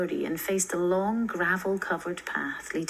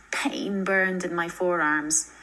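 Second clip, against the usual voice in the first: ordinary delivery, nothing marked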